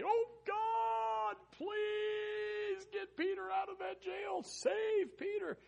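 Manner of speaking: high, drawn-out pleading cry; acted desperate prayer. People praying for Peter in jail, hammed-up pleading cry. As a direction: plead loudly, stretching every word skyward.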